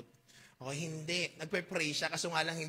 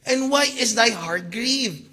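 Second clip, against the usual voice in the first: pleading question; 3 semitones higher, 11 dB louder, slower than usual. Acted. Reads Elkanah's plea.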